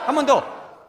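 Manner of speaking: loud, high command to repeat; rally the crowd at full volume; shouted urging. Real, not acted.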